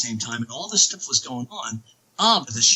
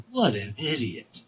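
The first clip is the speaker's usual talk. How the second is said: slow, mock-exasperated aside; eye-rolling scorn; 3 semitones lower than usual. Retelling how the angels mocked him, slow mock scorn. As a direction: voice the angels' unimpressed eye-roll. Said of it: "they rolled their eyes at me like".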